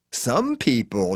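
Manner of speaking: slow, drawn-out brag; boasting. A narrator's reading. Faye boasts about herself to the hitchhiker, slowly. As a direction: savour every word of the boast. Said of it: "she boasts".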